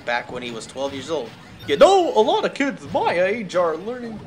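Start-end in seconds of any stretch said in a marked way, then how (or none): 1.7-4.2 higher, louder cartoon Goofy voice. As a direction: slip into Goofy for the dad's line. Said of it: "I can't Goofy".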